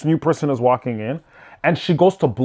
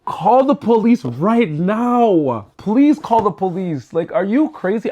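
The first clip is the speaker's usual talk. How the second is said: drawn-out, high, heated challenge; retold outrage, stretched and raised; mad yelling; 6 semitones higher, slower than usual. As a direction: dare her to call the police, slowly and high. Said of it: "I got mad".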